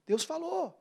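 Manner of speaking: ordinary delivery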